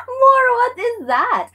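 high, drawn-out exclamation through laughter; laughing. Real, not acted. Teacher losing it at a chat member's answer, laughing. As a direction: cry it out slowly, cracking up. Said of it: "I can't stop laughing".

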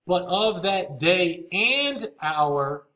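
slow reading that leans hard on "and"; stress on AND. Shown as a demonstration. Teacher reading scripture to listeners, stress on AND. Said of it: "I emphasize the word".

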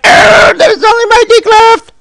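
put-on very high, loud, crying child's yell; desperate high-pitched child cry; panicked child shriek. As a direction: shriek it like a terrified kid. Trapped son cries out.

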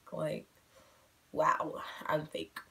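a whispered self-mocking aside, slow. Real, not acted. Caught being fake on camera, she whispers to herself. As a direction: breathe it out under your breath, teasing yourself.

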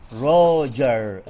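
"Roger" stretched with a strong first beat; stress on Roger. Shown as a demonstration. Teacher showing the stressed name, "Roger" drawn out. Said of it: "أيضًا ركزنا على الاسم".

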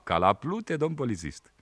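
put-on a village boy sassing the policeman; boy Sandu answering the policeman, high and cheeky. A narrator's reading.

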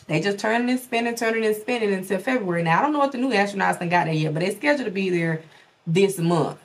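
ordinary talk

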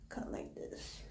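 soft whisper to herself. Real, not acted. Trimming her own hair, whispered to herself. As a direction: whisper it while concentrating on the cut. Muttering while cutting.

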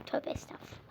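muttered whispered prayer; hushed pious whisper. Real, not acted. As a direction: whisper it under your breath.

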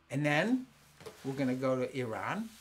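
normal talking voice

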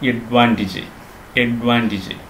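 അഡ്വാൻറ്റേജ് as "ed-VAAN-tij"; short "-ij" ending on അഡ്വാൻറ്റേജ്; correct pronunciation. Shown as a demonstration. Teacher modelling for learners, അഡ്വാൻറ്റേജ് with "-tij". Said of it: "അല്ല".

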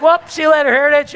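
high, loud, breezy goodbye; mock gleeful farewell. Pastor pretending to quit his church mid-sermon, mock cheery send-off. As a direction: toss off a joyful exit line.

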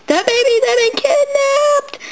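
high, urgent alarm; alarmed announcement. Pretend. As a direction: cry out the terrible news.

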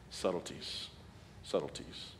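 unvoiced, hushed repeat; whispered emphasis. Real, not acted. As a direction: breathe the word out like a secret. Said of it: "repeated word hushed".

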